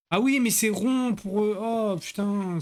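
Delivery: high, drawn-out exasperated groaning words; exasperated realisation. Real, not acted. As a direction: whine it slowly at your own blunder. Exasperated at confusing the game buttons.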